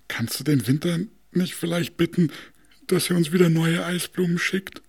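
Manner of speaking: high, meek asking voice; timid stove. Acted. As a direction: ask shyly, ashamed. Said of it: "fragte er den Nordwind".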